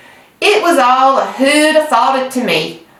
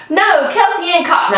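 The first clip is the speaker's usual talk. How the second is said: snapped; 8 semitones higher than usual. A narrator's reading. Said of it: "I snapped".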